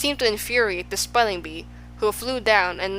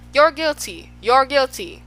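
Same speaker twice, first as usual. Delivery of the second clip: higher, louder repeated verdict; shouted accusation; 5 semitones higher, 5 dB louder than usual. A narrator's reading. Policeman accusing everyone he passes, shouting. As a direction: call out blame at everyone. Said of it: "stopping only long enough to shout".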